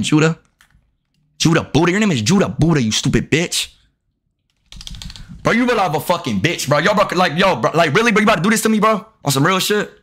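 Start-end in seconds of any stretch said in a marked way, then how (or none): none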